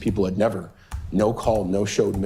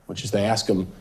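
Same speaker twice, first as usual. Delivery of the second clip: ordinary delivery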